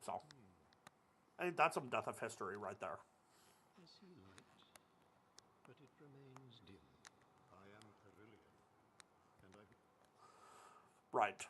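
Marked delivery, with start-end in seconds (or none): 3.8-9.6 faint, distant spirit voice; hushed otherworldly whisper; ghostly whisper. Acted. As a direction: murmur like a fading ghost. Reading NPC lines in character.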